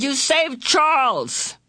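high, stretched-out triumphant cry; excited shout. A narrator's reading. Calvin hailing Meg as the rescuer, excited shout. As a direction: cheer it out high and slow. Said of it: "Calvin shouted".

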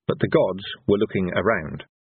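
ordinary delivery

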